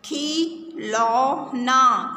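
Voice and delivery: drawn-out slow reading; slow careful word. Shown as a demonstration. Teacher reading the word slowly to the class. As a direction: stretch the word out slowly for children. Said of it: "ये शब्द जो खिलौना है, इसे ध्यान से देखो".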